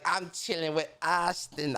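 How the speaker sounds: a slow, light impression of Barry's greeting; imitating Barry. Streamer imitating his friend Barry greeting a viewer, lightly mimicked. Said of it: "I can't do it as good as Barry when he be like".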